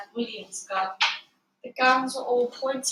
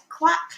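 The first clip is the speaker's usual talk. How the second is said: a higher, louder quack like a duck; 7 semitones higher, 5 dB louder than usual. A narrator's reading. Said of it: "said a voice at the other end".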